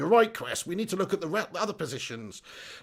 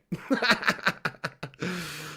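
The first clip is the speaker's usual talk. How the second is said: amused burst of laughter; real laugh; 4 dB quieter than usual. Real, not acted. Streamer finds the character's funny voice hilarious, laughing. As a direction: crack up at your own bad impression. Amused by his failed impression.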